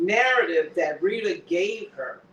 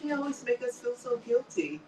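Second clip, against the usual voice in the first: a mocking echo of her line; a lightly mocking parrot; mocking repeat; 4 semitones higher, 6 dB quieter than usual. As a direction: mimic her excuse sarcastically. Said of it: "she knows she's guilty".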